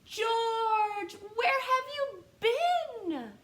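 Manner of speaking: high, loud little brother calling out; lightly voiced little brother shouting for George; little brother's call. A narrator's reading. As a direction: call out like an eager little kid. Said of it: "asked his little brother".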